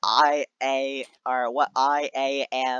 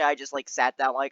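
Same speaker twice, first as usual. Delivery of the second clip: ordinary talk, nothing marked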